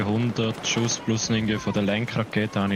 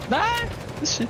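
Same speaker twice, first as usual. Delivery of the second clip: surprised. Real, not acted. Surprised at tank position.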